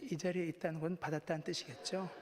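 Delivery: ordinary talk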